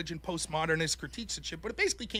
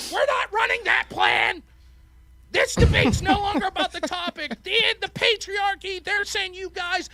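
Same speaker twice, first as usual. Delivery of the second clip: mock screaming rant; 10 semitones higher than usual. Debate opponents attacking over "you guys", mock screamed rant. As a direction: imitate opponents yelling in righteous outrage. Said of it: "They're screaming".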